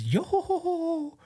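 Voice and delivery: very high, drawn-out "yo-ho-ho" cackle; imitated signature laugh. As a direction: laugh it out in falsetto on one held note. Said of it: "whose laugh goes".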